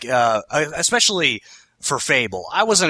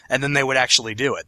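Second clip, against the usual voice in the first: normal talking voice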